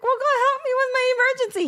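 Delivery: high, fast, squealed begging; hammed-up desperate wail; retold frantic plea. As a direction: plead in a mock panic. Acts out begging the child.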